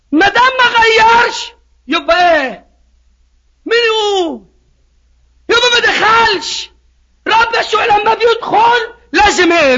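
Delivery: high-pitched preacher's cry settling into weighty declarations; full-force preaching. Real, not acted. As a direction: drive it home to the congregation, starting high.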